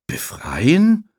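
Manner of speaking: high, rising echo in surprise; astonished. Acted. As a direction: act stunned by the word. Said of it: "fragt Emma verblüfft".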